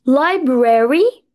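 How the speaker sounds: Library slowly as "LY-breh-ree", ending on a rise; careful pronunciation. Shown as a demonstration. Teacher stressing pronunciation to students, Library said slowly and carefully. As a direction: draw out Library syllable by syllable. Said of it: "pronunciation مهم قوي".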